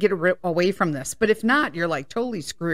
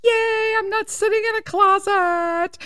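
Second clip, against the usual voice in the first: high, gleeful yay; excited squeal; 12 semitones higher than usual. Retold or imitated. Host retelling her joy at leaving the closet, high squeal. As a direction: squeal with childlike glee. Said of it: "And I was like".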